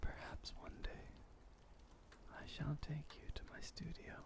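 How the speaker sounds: very soft whispered goodnight. Acted. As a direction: breathe the words so they stay asleep. Goodnight to sleeping listener.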